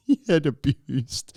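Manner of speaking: slow words squeezed through a laugh; breaking into laughter. Real, not acted. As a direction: crack up while finishing the reading. Cracks up while reading.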